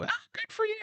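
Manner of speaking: very high mock-mother chirp; mom voice.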